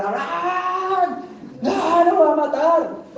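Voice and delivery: loud, high, drawn-out shout of his name; angel's shout. Retold or imitated. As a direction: call out to stop him, high and stretched. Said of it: "No, dice que gritó".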